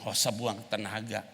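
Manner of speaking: normal talking voice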